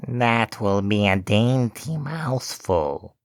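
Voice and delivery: slow witch voice, muttered to herself; lightly voiced witch relishing a meal; muttering witch. A narrator's reading. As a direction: savour the thought of eating them. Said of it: "she muttered to herself".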